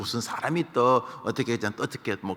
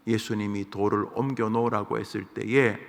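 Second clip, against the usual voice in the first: ordinary talk, nothing marked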